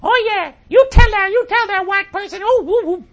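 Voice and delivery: very high falsetto whooping, very loud; hammed-up shrieking woman in the audience; mock crowd screaming. Host imitates a woman in the crowd cheering, mock scream. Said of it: "would scream out".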